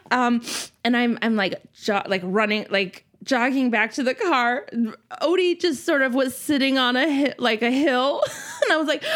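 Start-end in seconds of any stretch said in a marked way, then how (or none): none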